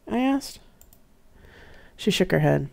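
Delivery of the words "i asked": ordinary delivery, nothing marked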